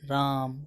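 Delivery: राम with its vowel held three times as long; drawn-out pluta vowel. Shown as a demonstration. Teacher shows a pluta vowel on राम to students, long held vowel. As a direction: stretch the vowel of राम like a distant call. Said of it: "जैसे हे".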